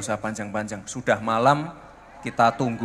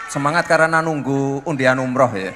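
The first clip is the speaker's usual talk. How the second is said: louder playful call out; teasing crowd; 4 semitones higher, 7 dB louder than usual. Real, not acted. Mayor teasing crowd waiting for raffle, loud playful call. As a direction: rib the crowd about the prize.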